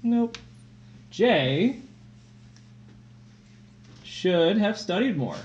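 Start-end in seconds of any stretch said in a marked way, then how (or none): 1.1-5.4 slow dictation. Shown as a demonstration. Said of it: "We're gonna say".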